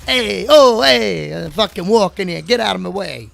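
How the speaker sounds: loud, high mock New Jersey yell; hammed-up New Jersey street shout; bad New Jersey accent. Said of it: "worst New Jersey accent ever".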